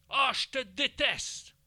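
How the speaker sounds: aigu, lent et appuyé, en boudant; enfant en colère. Acted. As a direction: jouer l'enfant qui crie sa rancune. (enfant joué, voix aiguë, lente et boudeuse)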